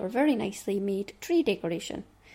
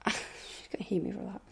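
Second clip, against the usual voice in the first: soft laughing whisper, half to herself; whispered aside; 2 semitones lower, 8 dB quieter than usual. Real, not acted. Teasing a designer who will hate the joke, whispered. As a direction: breathe it out as a cheeky aside.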